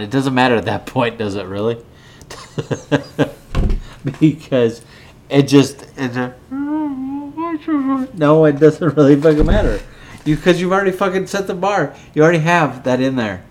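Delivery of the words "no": high, very slow stretched no; drawn-out no. Real, not acted. Drunk host stretching a word out.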